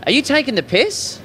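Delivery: Australian phrase demo. Shown as a demonstration. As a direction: model the phrase in an Aussie accent. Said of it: "Try this one".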